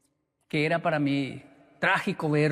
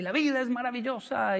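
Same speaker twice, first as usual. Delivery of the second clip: high, sing-song mock teacher cry; teacher's motto; 7 semitones higher than usual. The whole class parroting the teacher, a high sing-song cry. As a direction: chant it like the eccentric teacher. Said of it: "toda la clase era así".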